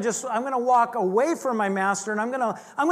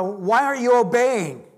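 louder mock mother's scold; scolding mom; 3 semitones higher, 5 dB louder than usual. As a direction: demand it like a cross mom. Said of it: "from your mom".

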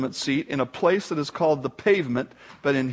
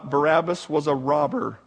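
normal talking voice

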